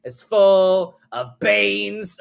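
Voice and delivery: slow, drawn-out bad British accent; sing-speak it like a cheesy British bloke accent; mock British accent. Quoting the Beans song line in a bad British accent. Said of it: "the bad British accent".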